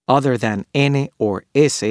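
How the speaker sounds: ordinary talk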